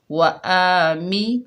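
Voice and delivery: slow chant of वा आ मी; slow recitation. Shown as a demonstration. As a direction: recite वा आ मी slowly and evenly. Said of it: "इस तरह करके पढ़ते हैं".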